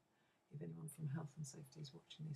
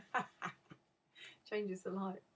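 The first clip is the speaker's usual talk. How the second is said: laughing. Real, not acted. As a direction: break into a real laugh as you restart. Laughs restarting the sentence.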